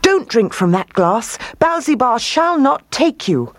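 high-pitched, urgent warning; dramatic storybook command, pitched high. A narrator's reading. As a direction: forbid it with rising force. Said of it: "he commanded".

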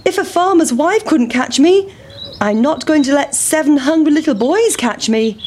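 high, taunting pancake voice; defiant pancake. Acted. Pancake taunting seven hungry boys, defiant.

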